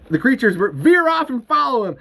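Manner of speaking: plain reading voice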